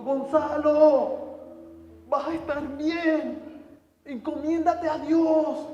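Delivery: very loud, high, slow drawn-out shout; shouting across. Retold or imitated. From his hospital bed to Gonzalo being taken away, a slow urgent shout. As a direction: shout his name across the room to reach him. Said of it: "le grito".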